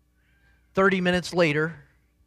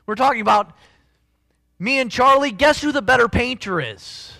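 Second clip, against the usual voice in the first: higher, louder, slowing into the build; full-force preaching; 8 semitones higher, 7 dB louder than usual. Acted. Pastor lifts his voice to the congregation, fervent preaching. Preaching climax about control.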